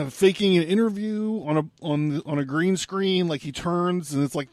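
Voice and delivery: normal talking voice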